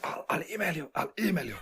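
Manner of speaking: high mock raspy Vader voice; imitating a raspy, croaky agent; Darth Vader voice. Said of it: "very raspy Darth Vader voice".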